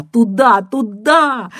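desperate woman shouting down the phone; strained cry. A narrator's reading. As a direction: cry it out, near breaking. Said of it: "крикнула она надрывно".

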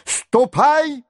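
high-pitched angry dismissal; irate old man's high cry. A narrator's reading. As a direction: order him out crossly, voice leaping up. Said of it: "закричал он сердитым и громким голосом".

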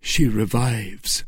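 usual speaking voice

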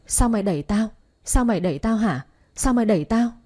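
ordinary delivery, nothing marked

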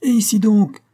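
high, loud cry; shouting. Acted. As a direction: yell it out to the whole house. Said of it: "cria le marmiton".

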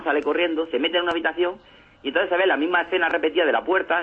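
usual speaking voice

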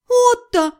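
high, urgent call to a friend; pleading call. A narrator's reading. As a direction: call him in to help. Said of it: "попросила я вмешаться".

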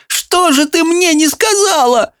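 high joyful cry. Acted. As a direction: call out in happy reproach. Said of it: "крича".

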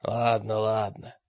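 hoarse, offhand concession. A narrator's reading. Мрак gives in to Олег, hoarse. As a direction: toss it off hoarsely. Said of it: "бросил мрак хрипло".

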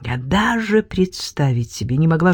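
usual speaking voice

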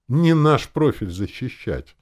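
loud, high, slow objection; displeased. A narrator's reading. Father objecting to the idea of protecting people, displeased. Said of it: "недовольно сказал он".

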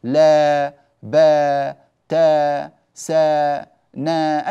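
each syllable "لا، با، تا، سا، نا" held long and loud; drawn open syllables. Shown as a demonstration. Teacher showing the class how any open letter carries the long alif. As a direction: hold each open vowel long and even. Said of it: "أي حرف مفتوح يؤدي هذا الغرض".

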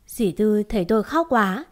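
plain reading voice

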